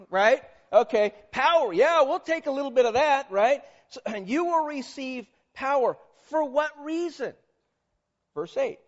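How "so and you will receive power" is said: ordinary talk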